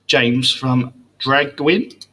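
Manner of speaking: slow and careful over the name; careful pronunciation. Real, not acted. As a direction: sound out the name slowly. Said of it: "hope I say this right".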